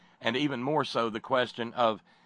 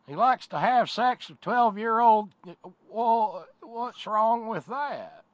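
mocking yokel; 10 semitones higher, 4 dB louder, much slower than usual. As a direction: play a clueless backwoods fellow, outraged then shrugging. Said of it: "a fellow look up and go".

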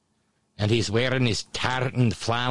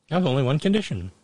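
mock quote; much faster than usual. Mocking replay of guest.

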